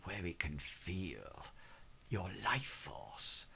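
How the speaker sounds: low, slow, hushed and unsettling; eerie slow whisper. Acted. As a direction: breathe it out like a secret ritual. Master describes amulet feeling the pulse.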